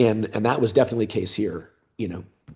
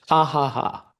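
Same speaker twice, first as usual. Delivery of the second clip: higher, spelled-out "ha ha ha"; mock laugh; 4 semitones higher, slower than usual. Friend laughing at the investor idea, mock laughter. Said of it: "he starts laughing at me".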